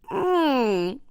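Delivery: a high shocked whimper; high mock whimper. Real, not acted. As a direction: squeak in mock dismay. Reacting to French operative twist.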